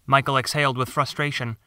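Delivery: ordinary delivery, nothing marked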